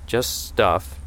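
ordinary delivery, nothing marked

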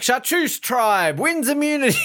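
high, slow drawn-out announcement; host's announcement. Acted. Mock announcing which tribe wins immunity, drawn-out host voice. Mimics a game show announcement.

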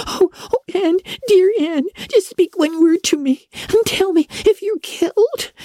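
high, sobbing plea; tearful pleading girl. A narrator's reading. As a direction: beg her friend to answer, near tears. Girl pleading over fallen friend.

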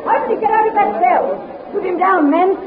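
high, urgent orders to shoot; angry commands. Acted. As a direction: order them to fire, alarmed. Queen orders soldiers to shoot.

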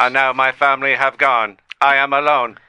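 mock-tragic robotic confession; lonely robot. AI left without family, lonely robotic.

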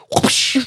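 loud voiceless whoosh; imitated whoosh. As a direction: make a sudden rushing-air noise. Imitates a Bible whipped out.

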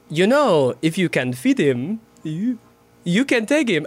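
imitated French accent with a slight break; French-accented line, fighting off a laugh; French accent, voice cracking. Actor imitates co-star stifling laughter onstage, in a French accent with a little break. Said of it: "that little break in his voice".

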